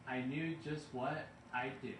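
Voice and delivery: usual speaking voice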